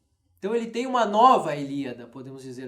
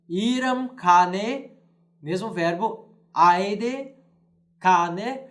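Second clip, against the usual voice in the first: deliberate Latin reading, key words louder; Latin verse reading; 4 dB louder, much slower than usual. Shown as a demonstration. Teacher reading the Latin opening to students, deliberate. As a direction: read each Latin word out slowly for the class. Reads Latin version aloud.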